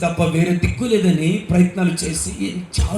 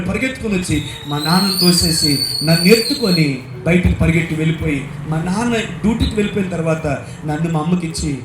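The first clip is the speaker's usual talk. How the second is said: ordinary talk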